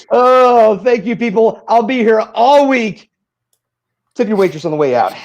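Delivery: high, loud showman patter; brash vaudeville comic. Acted. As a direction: ham it up like a lounge comic. Stand-up comic closing bit.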